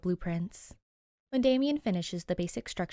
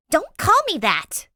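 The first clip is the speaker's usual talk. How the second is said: loud, high, slow furious outburst; angry snap; 11 semitones higher, 13 dB louder than usual. Acted. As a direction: shout it at him, stung. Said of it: "Danny snaps".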